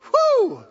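loud high whoop of delight; excited whoop. Real, not acted. Preacher whoops at the congregation, loud and high. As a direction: let out a joyful "whew". Preacher reacts to Peter's words.

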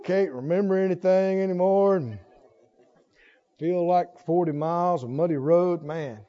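low, tired grumble about aging; mock weary complainer. Preacher imitating people who grumble about getting old, mock weary.

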